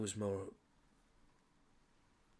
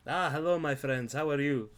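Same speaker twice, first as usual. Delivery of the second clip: louder, higher merchant welcoming customers; cheery shopkeeper greeting; 7 semitones higher, 9 dB louder than usual. Acted. Shopkeeper greets the adventurers entering his shop, hearty and loud. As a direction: boom out a hearty shopkeeper hello.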